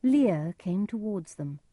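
ordinary delivery

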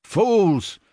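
high, scornful shout; angry shout. A narrator's reading. Benjamin shouting furiously at the animals. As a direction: shout them down in fury. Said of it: "shouted Benjamin".

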